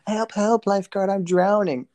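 high mock cry for help; mock damsel cry. Retelling a parody skit, high mock cry for help. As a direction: ham up a fake drowning plea.

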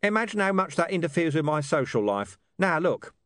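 high-pitched, colloquial talking-dog voice; Gaspode, streetwise and brusque. A narrator's reading. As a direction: rattle it off as a cocky street mutt.

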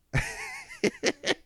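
high burst of laughter; laughing hard at the memory; real laugh. Real, not acted.

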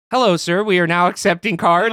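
hammed-up polite clerk greeting; mock cheery cashier. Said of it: "the lady goes".

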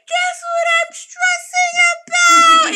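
very high, loud, slow mock wail; mock crying outburst. Retelling her breakdown to the girl, hammed-up crying wail. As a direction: wail it out as if bursting into tears. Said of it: "I just bust out crying".

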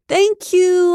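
high, loud faked-passion yell. A narrator's reading. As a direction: yell it back, playing along for the cameras. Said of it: "I yelled".

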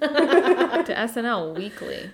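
laughing, slow, high-pitched mock whine; imitating friend. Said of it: "She's like".

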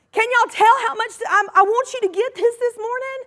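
ordinary talk, nothing marked

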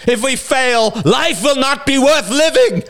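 high, screamed rallying cry; desperate shout. A narrator's reading. Bearded man urging his hesitating men, desperate scream. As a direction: shout them into action at full pitch.

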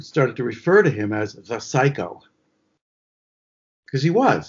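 normal talking voice

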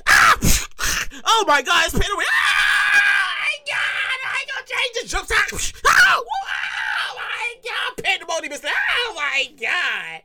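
high hysterical concert scream; acted pandemonium, wailing high; screaming fan. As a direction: shriek like a fan losing it in the front rows. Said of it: "Everybody all crying and screaming".

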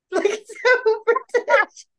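high, slow laughing words. Real, not acted. Laughing while telling story.

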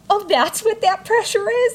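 very high squeal of surprise; amused realization. Retold or imitated. Patient realizes what the pressure is, high laughing surprise. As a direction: squeak the question, then dissolve into laughter.

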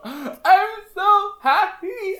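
high, slow squeal of joy; mother squealing with joy; overjoyed. Retold or imitated. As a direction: burst out with happiness to your daughter.

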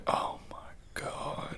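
slow, hushed re-enacted whisper; stunned whisper. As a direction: whisper it slowly, as if stunned and moved. Re-enacting reaction to character crying.

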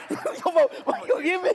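laughing mock scold.

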